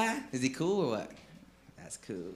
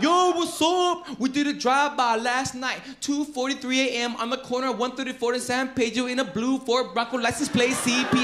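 rapper confessing; 6 semitones higher than usual. Retold or imitated. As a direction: play the rapper bragging on the mic. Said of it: "He come out".

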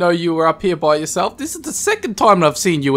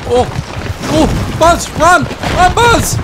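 high, loud urgent cries; alarmed, high-pitched bursts; panicked shouting; 9 semitones higher, 5 dB louder than usual. Real, not acted. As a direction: yell at your buddy to run. Urging Buzz to run from danger.

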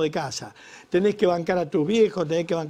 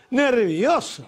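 mocking echo; 7 semitones higher, 6 dB louder, much slower than usual. Shown as a demonstration.

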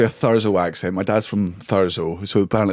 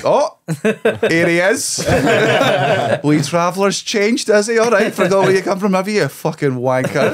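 high, loud mock jeering; heckling Scottish audience; 9 semitones higher, 3 dB louder than usual. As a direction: sneer like a home crowd cutting down a show-off. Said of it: "you can feel them being like".

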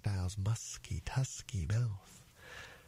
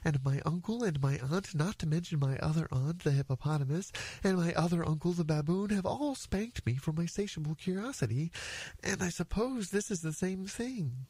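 lightly voiced young elephant, higher pitch; earnest elephant's child; 7 semitones higher than usual. Elephant's child explains his spankings to the python, earnest higher voice. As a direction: speak up as a polite, put-upon little creature.

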